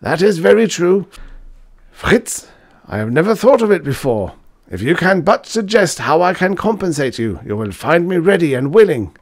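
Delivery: high and loud, eager to make amends; flustered old professor. Acted. Professor anxiously offering his pupil compensation. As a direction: hurry to appease him.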